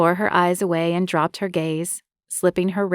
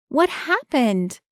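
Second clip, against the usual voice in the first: higher, slow, called-out woman's question; woman calling out; 7 semitones higher, slower than usual. Acted. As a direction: call out as Marie, worried. Marie calls out running over.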